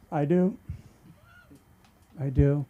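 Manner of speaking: ordinary delivery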